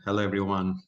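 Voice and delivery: normal talking voice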